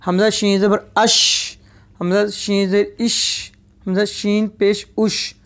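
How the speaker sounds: "अश", "इश", "उश" with the sh spread through the mouth; spreading sheen. Shown as a demonstration. Teacher demonstrates sheen, a spreading sh in "अश", "इश", "उश". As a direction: let the hush of "अश", "इश", "उश" spread out. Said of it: "आवाज़ जो है इसकी मुंह में फैलेगी".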